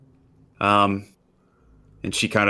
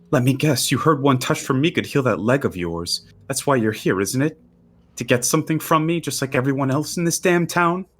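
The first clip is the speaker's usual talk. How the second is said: bitter accusation; 7 semitones higher than usual. Acted. Girl bitterly confronts the player.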